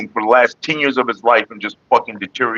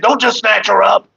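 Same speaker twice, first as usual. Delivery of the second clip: high, slow mock of a scolding woman; mock bossy woman; 11 semitones higher than usual. Retelling the woman who scolded him over his mom, mocking high voice. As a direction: mimic her telling him off, pitched high and drawn out. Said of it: "She said".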